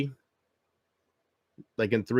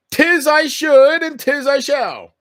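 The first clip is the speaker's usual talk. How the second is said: put-on high, loud, slow theatrical old English; archaic proclamation; 12 semitones higher, 8 dB louder, slower than usual. Streamer answering chat's advice, grand archaic declaration. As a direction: declaim it like a pompous knight.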